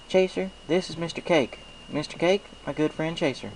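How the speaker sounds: cheerful country pony saying hello; Applejack's voice. A narrator's reading. Applejack greets the baker at the counter, cheerful.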